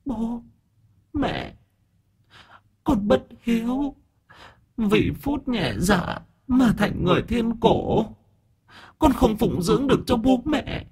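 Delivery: put-on high, soft, slow ghostly girl's voice; dead daughter's voice. Daughter's spirit through Long apologising to her parents, soft ghostly voice. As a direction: speak as the daughter's spirit, regretful. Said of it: "giọng thì đúng là của con Cúc".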